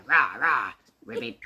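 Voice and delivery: low feeble dinosaur roar into a ribbit; half-hearted roar. Pretend. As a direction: attempt a deep roar, lapse into frog. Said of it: "Try and roar".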